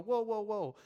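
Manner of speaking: loud, high stalling; mock alarm. Congregation balking at the firefighter, mock alarm. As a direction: hold up, hammed-up hesitation. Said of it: "And we go".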